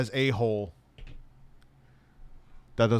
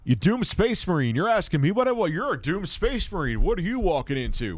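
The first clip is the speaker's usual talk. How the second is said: high bird taunt; 7 semitones higher than usual. Pretend. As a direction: taunt the space marine as the bird.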